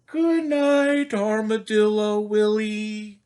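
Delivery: high, loud sing-song good night; cheerful zookeeper. Pretend. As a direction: call out goodnight brightly. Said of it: "and says".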